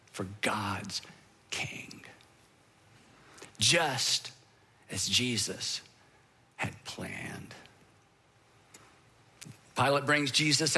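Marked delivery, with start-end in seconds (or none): 0.1-7.5 dropping to a breathy whisper; hushed reveal. Real, not acted. Preacher hushes the crowd on the icon, whispered. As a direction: let it fall away to a whisper.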